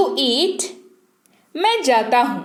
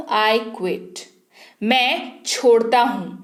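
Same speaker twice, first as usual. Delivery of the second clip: ordinary talk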